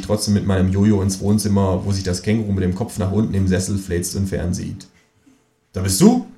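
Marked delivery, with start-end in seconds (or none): 5.7-6.3 higher, louder astonished call. A narrator's reading. Narrator surprised to see the kangaroo on TV. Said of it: "rufe ich erstaunt".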